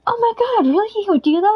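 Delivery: a surprised, grateful mom; amazed mom. Retold or imitated. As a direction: mom gasping at the stranger's offer, high pitched. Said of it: "They're like".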